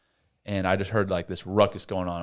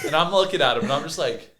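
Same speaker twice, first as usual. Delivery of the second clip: ordinary delivery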